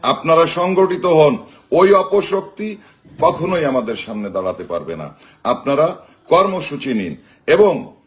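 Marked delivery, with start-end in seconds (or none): none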